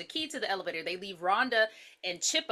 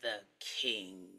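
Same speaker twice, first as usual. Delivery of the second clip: Lion King line; 9 semitones lower, 6 dB quieter, much slower than usual. Retold or imitated.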